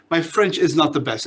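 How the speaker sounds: normal talking voice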